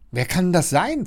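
startled question. A narrator's reading. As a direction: ask with a touch of fright. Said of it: "fragt Leon erschrocken".

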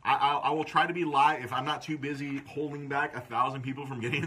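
ordinary talk

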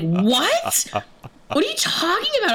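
high-pitched, disbelieving outburst; acting out baffled protest; incredulous. Customer reeling at the bartender's accusation, incredulous.

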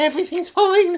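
high, loud posh voice. Pretend. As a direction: play it as a posh doctor. Acting twin doctors on sofa.

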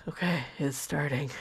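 low, very soft imitation; hushed bracing for it; nervous resignation. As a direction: say it small and low, under your breath. Acts out her nervous resignation.

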